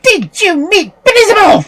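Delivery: high, loud, furious witch. A narrator's reading. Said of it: "in a furious tone she asked her".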